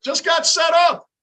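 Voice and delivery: slow, loud, high frustrated yell; frustrated shout. Real, not acted. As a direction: yell it out in disbelief. Repeats phrase then yells it.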